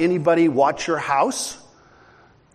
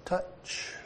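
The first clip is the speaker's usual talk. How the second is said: whispered touch; 16 dB quieter than usual. Retold or imitated. Toddler touches the forbidden thing.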